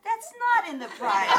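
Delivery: the line breaks into a laugh; laughing through it. Retold or imitated. Retelling the local man's new charge, laughing. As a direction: let the laugh take over the punchline.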